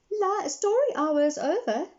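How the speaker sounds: put-on high, bright child's voice; lightly voiced young girl telling the lion; little girl voice. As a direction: talk like a small girl. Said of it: "a little girl told him".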